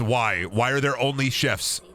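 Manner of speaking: ordinary talk, nothing marked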